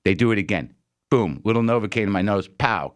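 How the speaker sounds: usual speaking voice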